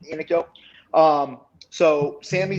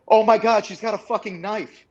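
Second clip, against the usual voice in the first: retold alarm; 7 semitones higher than usual. A bystander spots the knife, mock panicked shout.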